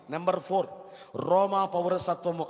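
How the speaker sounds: normal talking voice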